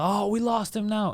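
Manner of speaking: mock drunk girl asking. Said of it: "She's like".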